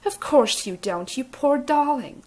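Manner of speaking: put-on slow doting Capitol accent; cooing Capitol accent. A narrator's reading. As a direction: coo over her like a pet in the Capitol accent. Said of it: "clasping her hands together in distress".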